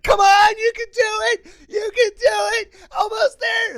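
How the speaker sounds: high, strained sideline cheering; very high-pitched urgent shout; motivational yell. Retold or imitated. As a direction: scream them on toward the goal. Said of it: "I yell out of motivation".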